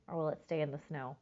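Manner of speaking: ordinary delivery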